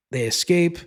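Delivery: ordinary talk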